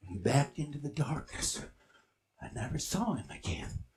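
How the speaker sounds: soft, low storytelling; hushed suspenseful narration. Acted. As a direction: hold the leap in suspense. Hushed end of encounter.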